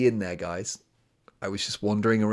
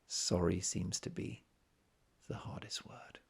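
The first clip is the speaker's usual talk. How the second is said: slow, hushed mock-solemn whisper; mock reverent whisper; 2 semitones lower, 10 dB quieter than usual. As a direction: savour each word in mock awe. Imitating songwriter's proud delivery.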